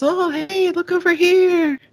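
high-pitched mock passerby shout; calling out. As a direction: call out to someone across the street. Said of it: "somebody else".

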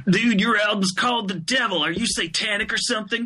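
high-pitched incredulous commenter voice; mock online commenter. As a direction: play a shocked internet commenter.

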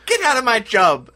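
high, loud mock-angry Irish-tinged shout; botched British turning Irish, yelled; accent slipping into Irish. Local yelling at immigrant, mock angry shout turning Irish. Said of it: "I think I turned Irish there".

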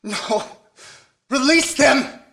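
loud pleading yell; desperate shout. Acted. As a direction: scream it in desperation. Family taken again.

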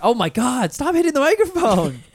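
loud, high-pitched exasperation; exasperated outburst. Real, not acted. Host scolds friend for hitting the microphone, exasperated. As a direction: exclaim in shrieking frustration.

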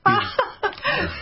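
high burst of laughter; laughing. Real, not acted. Host laughs at the accent.

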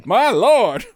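put-on loud, high, laughing old-timey lady; hammy stage actor. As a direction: clutch pearls and cry out. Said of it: "she just uses a bunch of old-timey slang insults".

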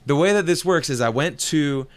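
ordinary talk

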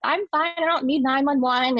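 high-pitched mock yell of protest; insistent, high cry, held back in volume; retold yelling. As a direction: re-enact yelling from the background, voice jumping high. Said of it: "I'm in the background yelling at him".

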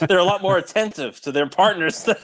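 giggling through the words; laughing while talking. Real, not acted. Laughs at own joke.

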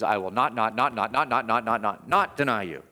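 not, not, not hammered over and over; stacked nots, insistent and emphatic; emphatic repeated not. Shown as a demonstration. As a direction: pile up each not for emphasis. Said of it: "Peter is saying is".

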